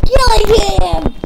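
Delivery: high, very loud game shout; triumphant yell. Real, not acted. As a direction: shout it out mid-fight. Excited kill in the game.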